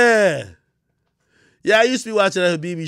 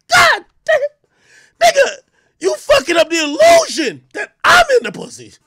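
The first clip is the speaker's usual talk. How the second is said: high, loud anguished yell; frustrated shout; 6 dB louder than usual. Retold or imitated. Reacting to the ruined porn scene, with a high loud wail. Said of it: "I'm like".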